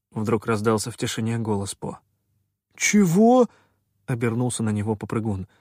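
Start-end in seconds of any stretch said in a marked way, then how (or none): 2.7-3.5 high, loud, startled question; incredulous. A narrator's reading. Попрыгун turns on Po, incredulous. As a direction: burst out in disbelief.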